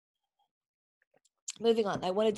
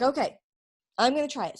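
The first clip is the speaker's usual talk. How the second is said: higher, louder, eager okay; eager resolve; 4 semitones higher, 7 dB louder, faster than usual. Retold or imitated. Mom deciding to try the oil on her son, eager. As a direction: jump in, ready to try it.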